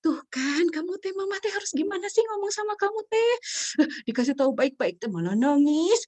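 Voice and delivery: high, loud mock complaining; exasperated mother. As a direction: whine like a mom at her wits' end. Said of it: "kita akan bilang apa?".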